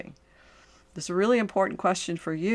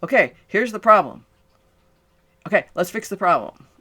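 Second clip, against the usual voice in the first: transactional coach; 3 semitones higher, 6 dB louder than usual. Retold or imitated. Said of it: "we think this transactional".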